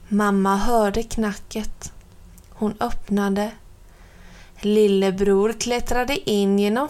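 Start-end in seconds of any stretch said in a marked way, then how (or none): none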